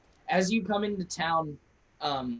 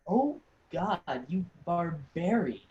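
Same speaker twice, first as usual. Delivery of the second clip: appalled nobleman. Acted. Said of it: "He goes".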